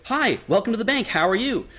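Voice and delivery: high, bright bank-teller greeting; polite service voice. Shown as a demonstration. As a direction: lift the pitch to please the customer. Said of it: "professional setting".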